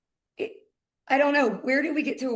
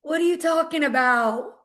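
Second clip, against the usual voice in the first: higher, louder attempt at an Alabama accent; mock Alabama accent; 4 semitones higher, 4 dB louder than usual. Teasing a colleague by imitating her, mock Alabama accent. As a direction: put on a hammed-up Alabama accent. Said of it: "in her Alabama accent".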